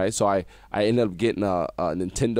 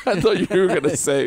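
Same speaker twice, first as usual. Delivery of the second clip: laughing; 10 semitones higher, 4 dB louder than usual. Real, not acted. Laughs recalling fear of firing.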